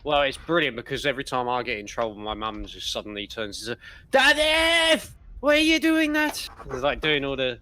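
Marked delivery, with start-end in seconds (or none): 4.1-6.5 high, loud mock Welsh scolding; angry Welsh mum. Son imitating his angry mum scolding dad, in a high, loud mock Welsh accent. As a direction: mimic mum turning Welsh when cross. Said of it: "all the Welsh, you know, angry".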